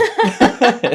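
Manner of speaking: loud high laugh. Real, not acted. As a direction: burst out laughing. Host laughs.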